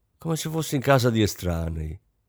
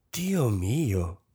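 softer, a weary sigh on the words; 4 dB quieter than usual. A narrator's reading. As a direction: let it out as a tired sigh. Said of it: "sospirò la signora Kampf". (sospiro stanco e sconsolato)

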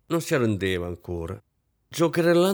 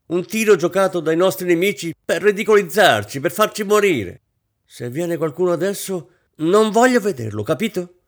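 high, loud, mounting tirade; growing vehemence; 8 semitones higher, 7 dB louder than usual. A narrator's reading. Hostess cancelling the ball, increasingly vehement. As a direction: rage on, more and more hysterical. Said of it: "continuò con veemenza crescente".

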